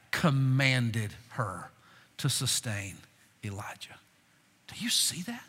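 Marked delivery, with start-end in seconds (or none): 4.7-5.4 hushed whisper to the room; a sudden stage whisper. Real, not acted. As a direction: lean in and whisper the question. Preacher whispers to congregation.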